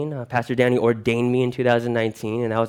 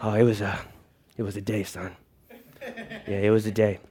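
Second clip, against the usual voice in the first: weary caveman father; 5 semitones lower, 7 dB quieter than usual. Acted. Caveman father answers son.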